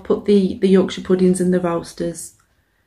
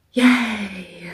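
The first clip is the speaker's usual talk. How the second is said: sarcastic yay. Pretend. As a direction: fake cheer, sarcastic. Sarcastic cheer about work.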